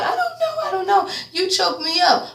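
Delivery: high, mild mock distress; plead, a touch choked up; high lightly voiced distraught Marcy. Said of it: "Marcy asked distraught".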